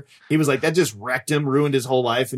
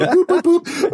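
high-pitched spoken boops; high cartoon beeping; button beeps; 3 dB louder, slower than usual. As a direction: boop like buttons being pushed. Imitating chest button presses.

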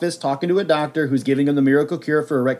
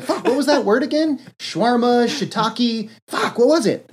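much higher, scrambling to remember; frantic kid; 9 semitones higher than usual. Retold or imitated. Kid forgetting the wizard's word, high mock panic. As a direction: flail through wrong guesses in a mock panic. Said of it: "And he was like".

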